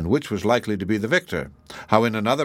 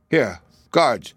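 brisk royal summons, slightly raised; a king's mildly raised command; calling out; 4 dB louder than usual. A narrator's reading. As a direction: call the guards over with authority. King calls his guards.

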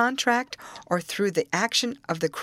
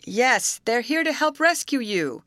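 high, raised-voice answer; calling out; 8 semitones higher, 4 dB louder than usual. A narrator's reading. Rescuer calling back to the man in the shack, shouting. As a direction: call reassurance across the camp.

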